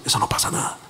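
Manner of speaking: whispered inner voice; self-talk whisper. Retold or imitated. A person whispers the lie to himself, hushed. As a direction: whisper it to yourself as temptation. Telling yourself.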